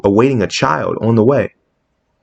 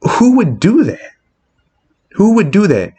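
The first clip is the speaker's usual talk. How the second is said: high, drawn-out incredulous question; appalled disbelief; 9 semitones higher than usual. Real, not acted. Fan condemning a friend's betrayal, aghast. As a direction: ask it high and aghast, then repeat it.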